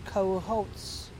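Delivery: ordinary delivery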